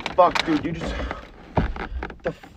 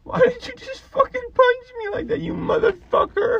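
shrill indignant cry, much higher; outraged high yell; 3 dB louder than usual. Real, not acted. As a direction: demand an answer, outraged. Just got punched by friend.

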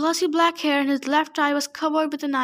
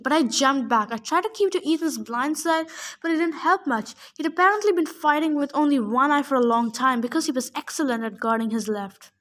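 plain reading voice

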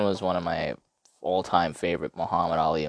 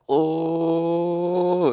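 high, loud excited exclaim; awed reaction; pumped-up gasp; hyped oh; 11 semitones higher, 5 dB louder than usual. Retold or imitated.

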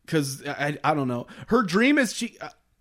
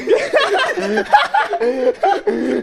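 high-pitched burst of laughter; long, high cackling laugh; laughing hard; 4 dB louder than usual. Real, not acted. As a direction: crack up at your own joke. Cracking up at catfish suggestion.

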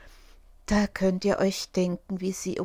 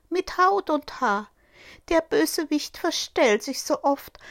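higher, caring mother goat voice; a mother goat warning her kids, higher and urgent; 6 semitones higher, 3 dB louder than usual. Acted. Mother goat warns her seven kids, higher and urgent.

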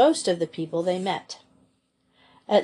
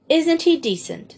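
high, louder amazed question; 8 semitones higher, 4 dB louder than usual. A narrator's reading. Joey on the departing train, amazed and delighted. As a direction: marvel at his kindness. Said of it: "said Joey amazedly".

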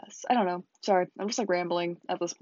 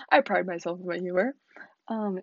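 usual speaking voice

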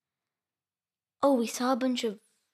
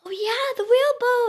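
high, excited exclamation; delighted; 13 semitones higher, 6 dB louder than usual. Real, not acted. Child reminded of a birthday boat trip, delighted. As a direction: burst in with happy recognition.

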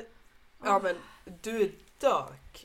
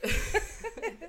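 high burst of laughter. Real, not acted. Laughing at the question.

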